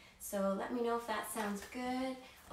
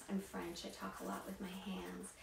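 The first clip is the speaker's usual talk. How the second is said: whispered aside; 7 dB quieter than usual. Real, not acted. Aside about being French.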